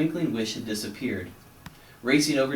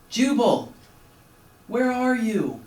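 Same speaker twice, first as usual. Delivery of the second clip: high call from far off; distant call; 11 semitones higher, 4 dB louder, slower than usual. A narrator's reading. Rescuer calls from the distance, faint far call. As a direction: call out across the storm. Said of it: "A faint voice called in the distance".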